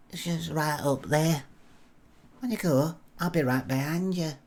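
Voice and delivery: lightly imitated Blackpool woman's voice; Blackpool accent. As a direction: coax him along with a put-on Blackpool accent. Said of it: "is that a Blackpool accent you've got".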